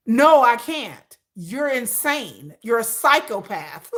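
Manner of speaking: slow, deliberate, spelled-out reply; slow deadpan. Retold or imitated. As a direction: drag each word out flatly. Mock answer to fans.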